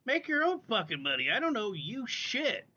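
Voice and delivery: retold angry comeback to the streamer; retold angry retort. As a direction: snap back at her. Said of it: "I'm like".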